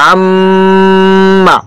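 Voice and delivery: আম্মা with a long, steady hum on ম; held ghunnah. Shown as a demonstration. Teacher demonstrating ghunnah to students, আম্মা with prolonged ম. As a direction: hold the ম of আম্মা very long, then release. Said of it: "এখানে কিছুক্ষণ সময় দূরে রাখতে হবে".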